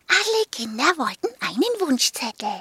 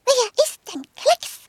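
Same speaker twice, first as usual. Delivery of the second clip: higher, curious dog voice; curious little dog; 11 semitones higher, 4 dB louder than usual. Acted. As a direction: ask it high and curious. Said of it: "schaute ihn neugierig an".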